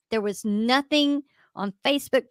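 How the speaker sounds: ordinary talk, nothing marked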